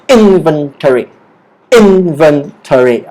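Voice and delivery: slow "inventory", first syllable held; stress on IN. Shown as a demonstration. Teacher models for later practice, slow stress on "in". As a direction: stretch "in", then step down. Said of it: "The stress is on the word in".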